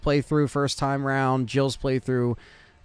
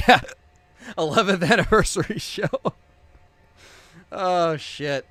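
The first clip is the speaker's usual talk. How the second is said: laughs through the words, higher and louder; giggling at his own slip; laughing; 6 semitones higher, 3 dB louder than usual. Real, not acted.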